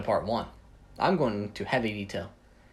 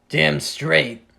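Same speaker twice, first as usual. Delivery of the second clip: loud, emphatic acted agreement; higher, punched-out reply; voice-straining exclamation; 4 semitones higher, 9 dB louder than usual. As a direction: belt it out proudly in character. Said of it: "Ugh, my damn voice".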